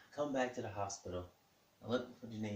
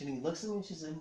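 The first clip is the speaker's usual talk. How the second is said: ordinary talk, nothing marked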